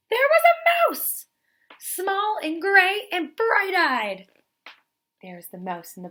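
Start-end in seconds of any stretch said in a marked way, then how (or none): 0.1-4.3 much higher, louder, slow astonished voice; big dramatic reveal. A narrator's reading. Teacher revealing the mouse again, astonished. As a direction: gasp it out like a shocking sight.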